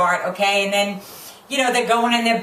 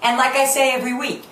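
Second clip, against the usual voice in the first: normal talking voice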